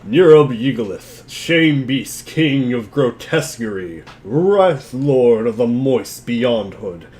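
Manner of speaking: slow, loud mock-epic recitation; grand monster lore reading. Reader performing the grimoire entry on request, slow and grand. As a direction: declaim each title like a herald. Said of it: "Say the word".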